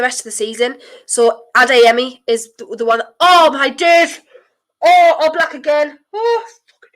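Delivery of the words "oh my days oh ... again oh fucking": loud high despairing wail; anguished cry. Real, not acted. Nervous match moment.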